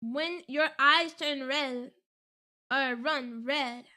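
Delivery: slow, deliberate reading; careful, unhurried re-read; slow rereading. Shown as a demonstration. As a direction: read it out slowly, phrase by phrase. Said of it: "Read it again slowly".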